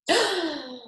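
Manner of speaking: high gasp of surprise; startled storybook gasp; shocked gasp. Acted. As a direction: gasp as the monster appears. Mouse sees the real monster.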